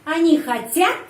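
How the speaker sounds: хотят rises high on the last syllable; emphatic questioning lift on хотят; question stress. Shown as a demonstration. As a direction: ask by pushing the end of хотят up.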